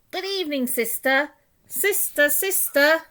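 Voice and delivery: high calling duck voice; quacking call. A narrator's reading. Duck calls to her sister, high ringing call. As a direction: call into the cave. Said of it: "She quacked".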